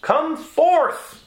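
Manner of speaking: high ringing call, held long; commanding shout across a crowd; loud cry. A narrator's reading. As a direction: call the dead man out of the tomb. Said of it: "he cried with a loud voice".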